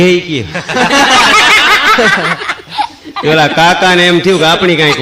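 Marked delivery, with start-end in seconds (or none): 0.5-3.2 high rising laugh; real laugh at the punchline. Real, not acted. Laughing at own joke.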